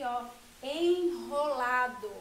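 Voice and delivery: enrolado said slowly and loudly, the R in enrolado strong; strong R example. Shown as a demonstration. Teacher to children sounding out the example word. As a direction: stretch out enrolado to show its strong R. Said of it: "igual esse exemplo aqui".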